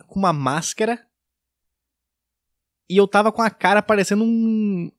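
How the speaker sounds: ordinary talk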